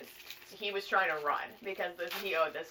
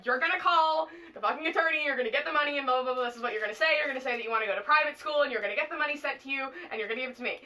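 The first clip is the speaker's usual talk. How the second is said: higher-pitched, insistent mock orders; demanding dad; 5 semitones higher, faster than usual. Dad ordering Theo to get the money, retold as insistent demands. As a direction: act out a dad laying down orders, quickening through the middle.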